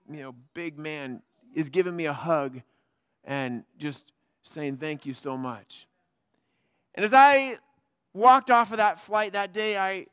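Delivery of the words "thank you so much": softer and lower, slow and heartfelt; tearful thanks. Retold or imitated. Grown man hugging the pastor after praying, soft and tearful. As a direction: hush it out, moved almost to tears.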